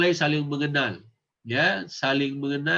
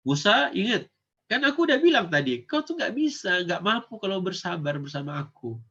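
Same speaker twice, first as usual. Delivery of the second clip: higher and louder, reproachful teacher; Khidir reproaching; 5 semitones higher, 3 dB louder than usual. Acted. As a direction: remind him sharply he can't be patient. Khidir scolds Musa.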